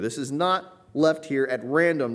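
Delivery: normal talking voice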